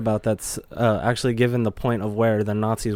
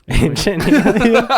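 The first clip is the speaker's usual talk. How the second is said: high dramatic laughing echo; 10 semitones higher, 8 dB louder, slower than usual. Pretend. Echoes show title in a voice.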